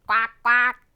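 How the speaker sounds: two loud quacks; duck quacks. A narrator's reading. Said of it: "said a duck".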